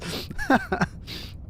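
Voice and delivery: short high, breathy laugh; giggle. Real, not acted. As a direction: giggle at your own muddled brain. Laughs at being too tired.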